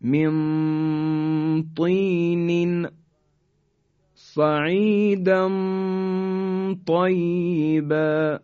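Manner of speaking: من طین recited very slowly, the ن hidden before ط; slow Quranic recitation of the examples; ikhfa recitation. Shown as a demonstration. (تلاوت آهسته با اخفای نون)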